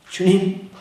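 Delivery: ordinary talk, nothing marked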